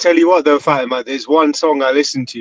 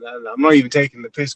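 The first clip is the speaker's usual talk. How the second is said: ordinary delivery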